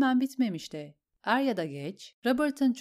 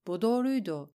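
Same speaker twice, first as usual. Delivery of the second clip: usual speaking voice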